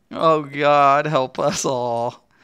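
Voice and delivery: usual speaking voice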